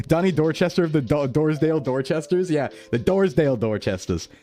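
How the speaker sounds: ordinary delivery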